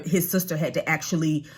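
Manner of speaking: ordinary delivery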